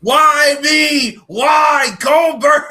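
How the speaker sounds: high, loud, slow anguished yell; mock outraged shout. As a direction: cry out to the heavens in mock despair.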